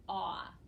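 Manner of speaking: "ah" as one open vowel; vowel team sound. Shown as a demonstration. Teacher demonstrates what A U says, an isolated "ah". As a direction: sound out "ah" on its own. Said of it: "It says".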